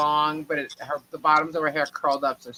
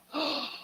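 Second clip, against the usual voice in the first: shocked gasp; 10 semitones higher, 9 dB quieter than usual. Retold or imitated. Seeing bald sister.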